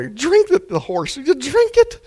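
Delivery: high, loud pleading command; urging outburst. Acted. Preacher urging a horse to drink, high pleading. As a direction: urge the stubborn horse to drink.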